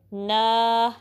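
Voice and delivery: ना held for two counts; long open vowel in ना; two-count stretch. Shown as a demonstration. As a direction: draw ना out evenly. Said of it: "ना को to count stretch करना है".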